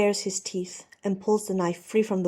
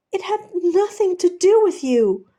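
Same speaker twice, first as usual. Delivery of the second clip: high, loud desperate plea; shouting; 11 semitones higher, 10 dB louder than usual. Acted. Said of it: "for shouting".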